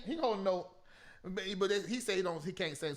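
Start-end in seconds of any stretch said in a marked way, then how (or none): none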